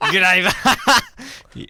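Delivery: big, slow, high Aussie shout; over-the-top Australian accent. Acted. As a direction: draw out a hammed-up Australian greeting. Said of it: "Is that Australian?".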